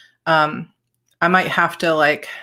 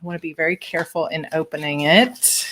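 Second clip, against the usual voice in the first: normal talking voice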